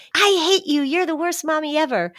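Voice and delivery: high-pitched mock tantrum; little kid. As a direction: hurl it like an upset small child, hammed up. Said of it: "a little kid that says".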